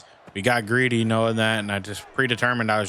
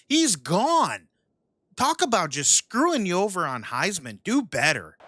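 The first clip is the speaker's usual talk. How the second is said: exasperated yelling; 13 semitones higher than usual. Real, not acted. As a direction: rant in total disbelief at the blown play. Rant at terrible game play.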